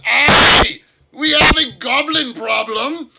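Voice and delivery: high, loud gruff dwarf bellow; dwarf shout. Acted. Grumpy dwarf at the table yelling at the party. Dwarf bursts out without looking.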